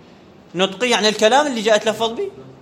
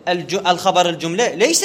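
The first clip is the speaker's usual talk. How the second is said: ordinary delivery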